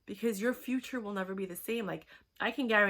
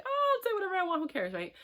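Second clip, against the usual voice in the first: much higher, airy who-cares voice; mock carefree voice; 11 semitones higher, 3 dB louder, faster than usual. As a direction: sing it out like you don't care.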